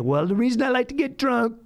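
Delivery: put-on high-pitched self-pitying drunk; mock sad. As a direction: confess like a sad drinking buddy. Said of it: "then it's gonna get sad".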